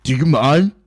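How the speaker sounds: put-on loud annoyed dad snapping at a noisy kid; a mock irritated "do you mind". Dad behind him in the theater, mock annoyed shush. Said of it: "this dad behind me goes".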